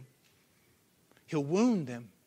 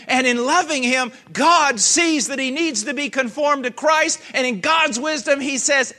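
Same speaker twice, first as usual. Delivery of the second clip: loud, higher preaching at full force; fiery preaching; 6 semitones higher, 9 dB louder than usual. Acted. Preacher pressing the point on the congregation, loud.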